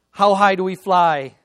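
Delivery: loud, punchy quiz question; rallying call. Retold or imitated. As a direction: fire the question out like a coach. Father quizzes daughter in rallying chant.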